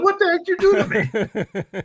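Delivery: high, loud outraged yelp; mock indignation. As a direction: imitate a son's loud, incredulous protest. Son's outraged reaction to the book.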